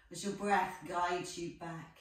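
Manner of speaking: ordinary talk, nothing marked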